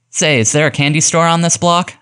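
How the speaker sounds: high-pitched, mildly desperate question; understated desperation, pitched well up. A narrator's reading. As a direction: ask the kids with a touch of desperation. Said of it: "I asked in desperation".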